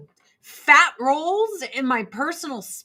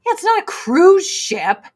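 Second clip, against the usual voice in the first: usual speaking voice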